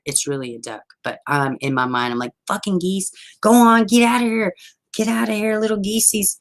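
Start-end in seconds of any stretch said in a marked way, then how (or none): 2.5-6.4 high-pitched scolding shoo; exasperated retold holler; mock shooing geese. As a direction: shoo them away, voice pitched up.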